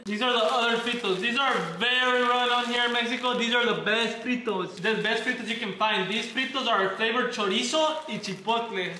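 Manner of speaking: ordinary delivery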